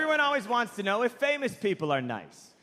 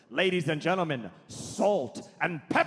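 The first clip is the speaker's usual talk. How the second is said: Patrick Stewart; 2 semitones lower than usual. Retold or imitated. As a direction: announce with huge surprise on the final name. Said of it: "this is how he introduced the musical guest".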